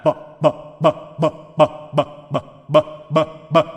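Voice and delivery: sílabas "bo" e "ba" curtas e repetidas; exercício labial. Shown as a demonstration. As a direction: estale os lábios em cada sílaba, ritmado. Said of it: "vamos aquecer os nossos lábios". (aquecimento dos lábios com "ba, ba")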